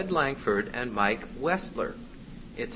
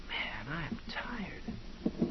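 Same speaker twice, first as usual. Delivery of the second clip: slow whisper of mock weariness; whispering demon boss; 13 dB quieter, much slower than usual. Demon slips a thought into her ear, whispering. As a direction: whisper a long, drawn-out tired groan. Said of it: "Boss goes up and goes".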